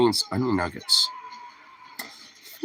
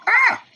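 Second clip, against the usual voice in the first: high startled yelp; sharp yelp; 5 dB louder than usual. Real, not acted. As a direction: yelp at a burning sting. Strong mint hits suddenly.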